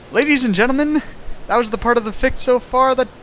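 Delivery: mock announcer. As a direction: announce it like a ringmaster.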